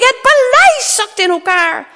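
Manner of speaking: high, loud royal warning; alarmed shout. Acted. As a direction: cry out that disaster is striking. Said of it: "riep de koning". (luid, paniekerig roepen)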